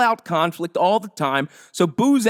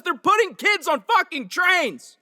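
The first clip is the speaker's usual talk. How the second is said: losing his cool; 11 semitones higher than usual. Real, not acted. Comic ranting at Russia, angry peak. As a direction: let the anger spike on the last words. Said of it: "I apologize for losing my cool".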